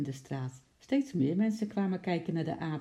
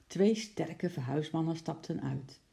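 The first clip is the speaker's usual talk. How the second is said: ordinary delivery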